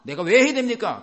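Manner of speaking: high, loud protesting question; indignant protest. Retold or imitated. Believer refusing God's mission, loud protest. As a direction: balk at the order, loudly offended.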